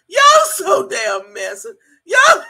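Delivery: high, loud, helpless laughter; real laughing fit. Real, not acted. Streamer cracking up at messy chat, laughing hard. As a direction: burst out laughing through the words.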